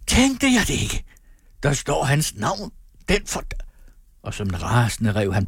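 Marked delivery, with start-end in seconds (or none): 0.1-3.4 high-pitched exclamation, breaking off; outraged cry. A narrator's reading. Brandt spots his rival's name on the book, outraged cry. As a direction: cry it out far above your usual pitch. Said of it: "så på titelbladet og skreg".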